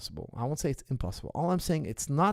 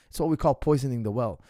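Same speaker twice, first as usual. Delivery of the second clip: ordinary delivery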